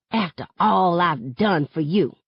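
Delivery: plain reading voice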